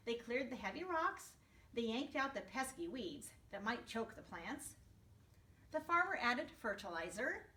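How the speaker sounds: plain reading voice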